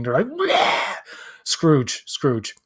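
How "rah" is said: high-pitched hammed-up scream; mock frightened shriek. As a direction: imitate a craggy old woman shrieking. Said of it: "see something the least bit frightening".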